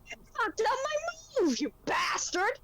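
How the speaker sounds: high-pitched outraged squawk; indignant mock yell. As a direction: yell at the corpse that spoiled your moment. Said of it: "I'm just yelling at the corpse".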